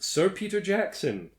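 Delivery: ordinary talk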